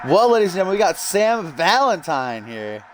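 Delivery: announcer intro. Acted. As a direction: present the guest like a ring announcer. Hosting a stream, introducing a guest.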